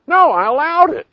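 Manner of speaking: high, loud exclamation; loud high protest; emphatic admission. Acted. Emphatic answer to own question.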